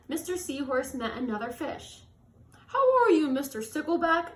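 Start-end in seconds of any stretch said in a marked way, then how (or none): 2.7-4.3 high, loud sing-song hello; cheery greeting. Acted. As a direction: call out a bright hello. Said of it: "asked Mister Seahorse".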